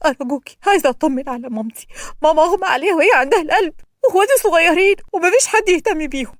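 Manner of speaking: pleading daughter. A narrator's reading. Prisoner begs the policewoman to check on her mother, pleading. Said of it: "عمالة تتوسل للشرطية".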